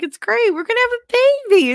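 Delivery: high, quick mock delight; gushing cheer. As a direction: squeal the good news. Retold forced cheer to friend.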